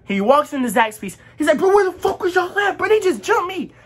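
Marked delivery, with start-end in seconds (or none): 1.6-3.7 high-pitched, indignant retold complaint; agitated outburst. Jumped friend bursts into Zaxby's, agitated outburst. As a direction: imitate a frantic friend bursting in.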